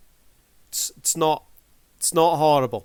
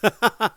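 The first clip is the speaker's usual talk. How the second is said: short, high burst of laughter; loud little laugh; 9 semitones higher, 6 dB louder than usual. Real, not acted. Reacting to the music just played.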